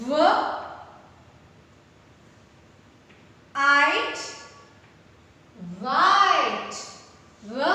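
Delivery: व आइट वाइट built up loud; phonics reading. Shown as a demonstration. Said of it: "So it is". (व और आइट जोड़कर वाइट)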